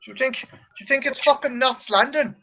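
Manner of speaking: high-pitched indignant tirade; mock rant. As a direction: rant in disbelief, voice up. Mock rant about peppers.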